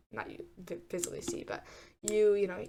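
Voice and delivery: ordinary talk, nothing marked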